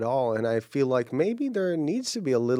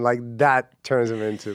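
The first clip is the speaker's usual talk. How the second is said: usual speaking voice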